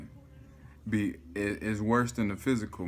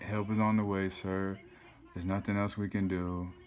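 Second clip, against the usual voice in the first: softer, detached operator voice, lightly voiced; calm dispatcher; 2 semitones lower, 6 dB quieter than usual. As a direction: soothe him like it's routine. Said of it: "she was so cool".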